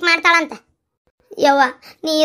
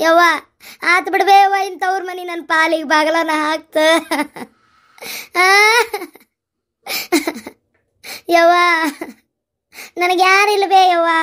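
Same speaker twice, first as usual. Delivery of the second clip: wailing to mother. Acted. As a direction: wail like a daughter with nowhere to go. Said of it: "ಅಳ್ಬೇಡ್ ಬಿಡುವ". (ತಾಯಿಗೆ ಅಳುತ್ತಾ ಕೂಗುವುದು)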